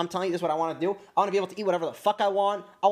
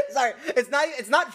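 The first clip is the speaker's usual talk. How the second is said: high giggling through the words; can't stop laughing; 11 semitones higher than usual. Real, not acted. Streamer laughing at a banned chatter, high giggles. Cracking up at appeal.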